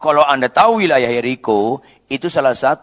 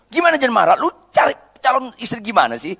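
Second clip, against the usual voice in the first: angry mother; 9 semitones higher, faster than usual. Acted. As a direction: tell him off, furious. (ibu memarahi anaknya, nada tinggi dan keras)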